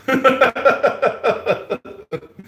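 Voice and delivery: high, loud laugh burst. Real, not acted. Boasting about her awesome jokes, a high loud laugh. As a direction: crack up at yourself.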